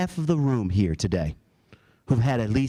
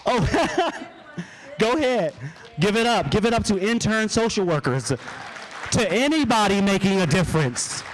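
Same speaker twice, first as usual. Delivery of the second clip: rousing; 8 semitones higher than usual. Real, not acted. Rallying the crowd to applaud.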